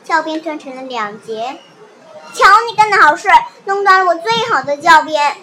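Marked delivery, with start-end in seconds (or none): 2.3-5.4 loud angry scolding; annoyed. Acted. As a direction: blame her loudly for the broken cane. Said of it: "丽兹恼了". (生气地大声责怪)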